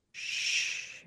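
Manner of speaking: soft unvoiced shush to a baby. Shown as a demonstration. Mother calming her crying newborn, soft shush. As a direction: hush the baby with a long shh. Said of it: "then we start shushing".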